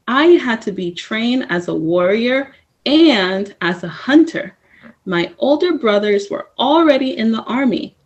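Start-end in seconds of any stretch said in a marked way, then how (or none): none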